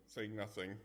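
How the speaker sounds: plain reading voice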